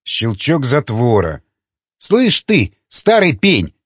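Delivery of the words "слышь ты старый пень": heated, high-pitched jab; growled challenge. A narrator's reading. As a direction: throw it out as an angry challenge. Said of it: "прорычал Ломака". (сердито, с вызовом)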